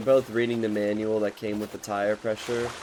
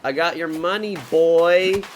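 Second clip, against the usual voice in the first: raised, cocky call of a guy running off with cash; mock taunting tow guy; 6 semitones higher, 7 dB louder than usual. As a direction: taunt him loudly, the last word nearly shouted.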